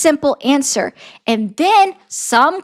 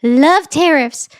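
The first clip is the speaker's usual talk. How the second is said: imitating Trump; 4 semitones higher than usual. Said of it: "That's Donald Trump".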